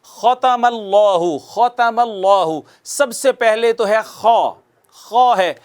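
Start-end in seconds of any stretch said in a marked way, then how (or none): 0.0-2.6 drawn-out tajweed reading of ختم اللہ; careful model recitation; slow recitation. Shown as a demonstration. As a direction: recite ختم اللہ slowly for students to follow. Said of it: "پوری توجہ کے ساتھ بیٹھیں".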